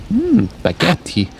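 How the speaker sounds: high, slow relishing "Hmm, baguette"; dreamy food craving, drawn out; savouring. Real, not acted. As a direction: savour it slowly, pitched right up.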